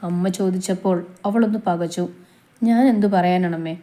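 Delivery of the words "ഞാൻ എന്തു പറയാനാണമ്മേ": plain reading voice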